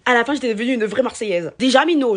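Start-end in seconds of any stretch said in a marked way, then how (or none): 1.6-2.2 mot marseillais appuyé, plus haut et fort; Marseille accent. Shown as a demonstration. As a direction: lance « Mino » à la marseillaise. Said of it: "je parlais le marseillais". (accent marseillais)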